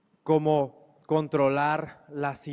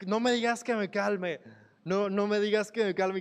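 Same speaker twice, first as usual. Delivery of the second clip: higher, mock-exasperated retort; imitating an exasperated person refusing to calm down; irritated retort; 6 semitones higher than usual. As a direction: snap back as if already upset.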